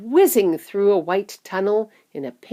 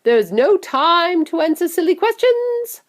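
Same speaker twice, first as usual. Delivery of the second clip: high, loud dismissal; impatient shout; 11 semitones higher, 6 dB louder than usual. A narrator's reading. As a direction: shout down the questions. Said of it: "shouted Mister Wonka".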